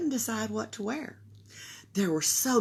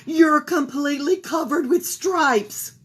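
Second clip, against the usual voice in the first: high, loud dismay; stunned at the stripes; mother exclaiming over her striped daughter; alarmed; 6 semitones higher, 7 dB louder than usual. Acted.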